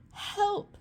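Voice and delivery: high, desperate cry for help. A narrator's reading. Witch crying for help as she flees. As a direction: call out in panic. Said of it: "cried the witch".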